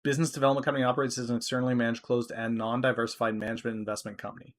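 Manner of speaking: rattling off the jargon at speed; rushed read. Real, not acted. To stream viewers, racing through a dense company description. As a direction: gabble through the company blurb. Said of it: "Say that ten times fast".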